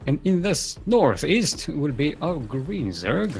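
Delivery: slow, drawn-out ring announcer. Acted. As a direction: introduce the players like a boxing MC. Player introduction.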